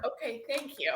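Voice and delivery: ordinary talk, nothing marked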